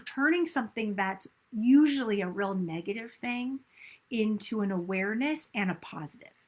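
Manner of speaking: ordinary delivery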